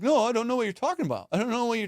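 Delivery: high, quick, wobbly denial; shaky scared voice. Retold or imitated. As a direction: imitate a scared kid losing his nerve. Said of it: "he's got that little shake in his voice".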